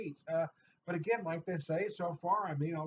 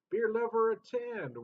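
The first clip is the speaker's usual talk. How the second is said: grand poem address; 8 semitones higher, 7 dB louder than usual. Acted. Recites poem with flourish.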